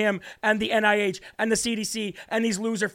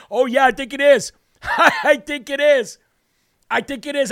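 loud, laughing gloat; taunting laughter, louder; 5 semitones higher, 6 dB louder than usual. Real, not acted. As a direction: laugh it in his face.

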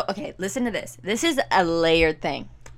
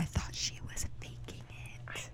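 hushed gossip whisper; 15 dB quieter than usual. Real, not acted. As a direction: whisper the confession. Gossip confession.

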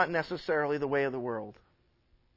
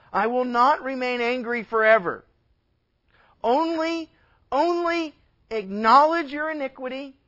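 higher and louder, urgent; pleading God; 5 semitones higher, 7 dB louder than usual. Acted. God pleading with backsliding Israel, loud urgent call. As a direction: call them back with force. Voicing God's plea loudly.

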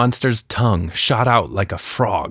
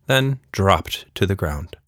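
ordinary delivery

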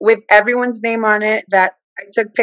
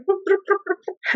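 much higher, soft popping boops; playful boops; 11 semitones higher, 7 dB quieter than usual. Retold or imitated. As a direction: tick off messages like beeps. Imitating rapid-fire messages.

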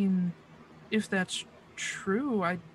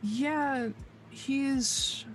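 Liz's voice; 6 semitones higher than usual. Acted. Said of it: "She's like".

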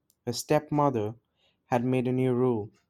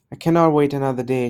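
declared; 7 dB louder, faster than usual. A narrator's reading. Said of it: "she declared".

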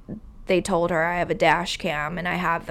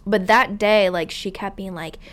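ordinary talk, nothing marked

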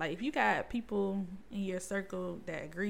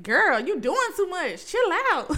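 loud, high-pitched mock scolding; blunt friend calling out; 9 semitones higher, 8 dB louder than usual. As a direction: imitate a friend loudly checking you. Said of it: "Gilcrease will tell me in a minute".

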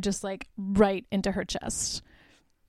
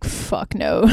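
deep, rasping demon growl, mostly breath; demonic Jennifer; 13 semitones lower than usual. Retold or imitated. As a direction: drop very low and growl it out like a possessed girl. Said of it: "Jennifer's like".

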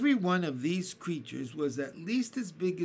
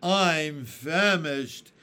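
put-on very slow, louder old grasshopper groan of hunger; famished grasshopper; 9 dB louder, much slower than usual. A narrator's reading. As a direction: drag it out, starving. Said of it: "the old green grasshopper said".

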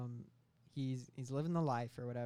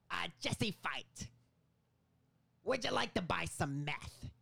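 slow, raised-pitch actor impression; Willem Dafoe; 4 semitones higher, 3 dB louder, slower than usual. Local meth dealer greeting his customer, hammed-up Willem Dafoe. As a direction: put on a theatrical meth dealer. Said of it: "Willem Dafoe says".

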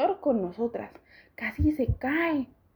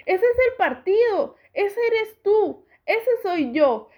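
much higher and louder, slowing down; excited shout; 8 semitones higher, 4 dB louder, slower than usual. A narrator's reading. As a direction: point out every bit with delight. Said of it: "ha gritado". (grito emocionado, más agudo y fuerte)

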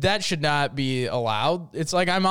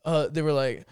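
normal talking voice

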